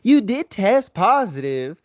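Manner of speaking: high, slow, singsong Southern cheer; hammed-up chirpy Southern lady; cheery Southern drawl. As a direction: mimic an old Southern lady delivering good news. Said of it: "with very cheery kind of disposition".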